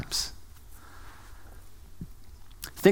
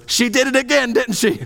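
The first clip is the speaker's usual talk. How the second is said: much higher, called out across the house; calling out from another room; 12 semitones higher than usual. Retold or imitated. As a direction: sing it out knowingly to the next room. Ray calls from other room.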